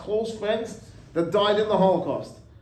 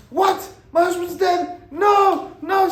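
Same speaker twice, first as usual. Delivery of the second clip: high, loud cries of disbelief; a widow hearing her husband is dead, screaming in disbelief; retold screaming; 13 semitones higher, 8 dB louder, slower than usual. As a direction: shout the denial like a shocked widow. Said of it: "Screaming and yelling".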